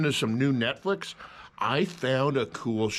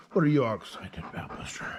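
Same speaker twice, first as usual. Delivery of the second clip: fast whispered question to the dog; 2 semitones lower, faster than usual. Real, not acted. Asking the excited dog again, in a whisper. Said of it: "same words whispered".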